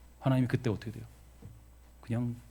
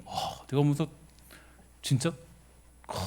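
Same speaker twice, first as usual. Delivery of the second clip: ordinary talk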